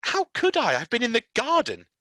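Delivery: put-on high, loud indignant girl; Mary defending herself, high and loud; sharp retort. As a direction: snap back at him.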